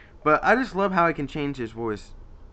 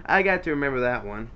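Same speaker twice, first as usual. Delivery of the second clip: normal talking voice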